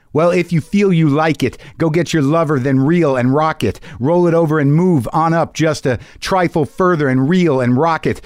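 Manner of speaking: half-chant the lyrics with swing, slowing on the last line; rock lyrics recited. Acted. Reads [name] lyrics as a poem.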